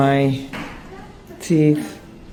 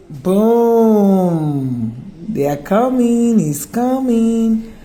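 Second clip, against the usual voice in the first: excited reveal; 6 semitones higher, 4 dB louder, much slower than usual. Real, not acted. Reveals her new veneers.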